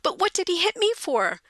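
put-on high, aggrieved little-boy voice; put-upon boy pleading his case; sulky kid. Kid makes excuses and bargains.

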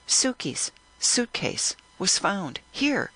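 slow and deliberate, each word spelled out; slowly repeated. Acted. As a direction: say it slowly for a tipsy listener. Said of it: "I repeated my words slowly".

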